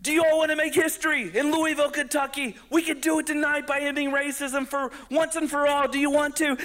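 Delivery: high, fast hype call to the crowd; hype-man. Retold or imitated. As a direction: rev the room up. Comic whipping crowd up.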